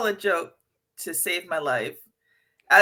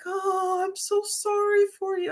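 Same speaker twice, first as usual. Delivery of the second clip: mock pity; 10 semitones higher, 4 dB quieter than usual. Mock sympathetic friends' reaction.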